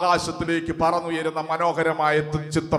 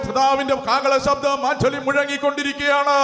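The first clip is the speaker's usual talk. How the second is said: rising, impassioned preaching cry, higher and higher; fervent preaching; 5 semitones higher than usual. Acted. Preacher calls the church to get ready, in fervent preaching. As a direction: preach it out with growing fire to the congregation. Preacher building to an altar call.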